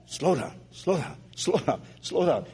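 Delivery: barely voiced, soft muttering of the words; silently mouthing through the train door; mouthed slow down. Woman at the train door mouthing her words quietly. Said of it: "And I could see her say".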